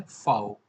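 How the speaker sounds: फाओ said with an F sound; German letter V. Shown as a demonstration. Teacher showing learners the V sound, फाओ with an F. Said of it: "वी की जो sound आएगी वो फे से आएगी".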